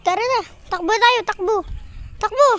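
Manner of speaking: loud, high calls while running; puffing out words mid-jog. Real, not acted. Running with the dog. (sumisigaw habang tumatakbo)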